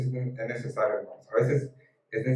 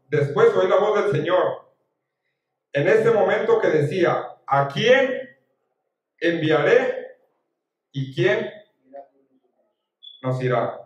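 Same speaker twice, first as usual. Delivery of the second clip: raised, high declaimed Bible reading; scripture proclamation; 6 semitones higher, 6 dB louder than usual. Acted. Preacher reading the voice of the Señor, proclaimed loudly. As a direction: ring out the Lord's call, pausing between phrases.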